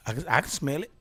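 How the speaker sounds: usual speaking voice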